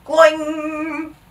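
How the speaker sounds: high held twang; springy boing noise. A narrator's reading. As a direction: ring it out like a sprung spring. Imitated noise of stretching oobleck.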